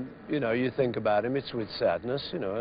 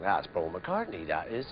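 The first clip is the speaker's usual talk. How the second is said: put-on slow, low, softer rural English country accent; Pam Ayres accent; 6 semitones lower, 8 dB quieter, slower than usual. Onlooker spotting Paul in the garden, slow, soft Pam Ayres accent. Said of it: "gone into a Pam Ayres accent".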